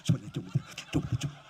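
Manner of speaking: fast voiceless gabble; rushed mock dhikr. Shown as a demonstration. Preacher mocking hurried worshippers, fast whispered gabble. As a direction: rattle it off like hot food. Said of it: "seperti makan pisang goreng panas".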